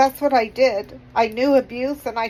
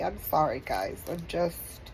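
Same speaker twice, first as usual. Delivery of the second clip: crying apology; 6 semitones lower, 8 dB quieter than usual. Real, not acted. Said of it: "I'm a blubbering idiot".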